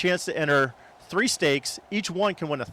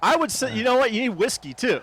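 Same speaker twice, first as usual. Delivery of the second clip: excited; 8 semitones higher than usual. Real, not acted. Excited suggestion.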